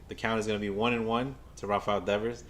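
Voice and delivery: usual speaking voice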